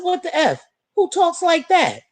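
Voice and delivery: high, outraged "what the F"; indignant disbelief. Retold or imitated. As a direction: pitch it up in shocked disbelief. Shocked reply to a troll.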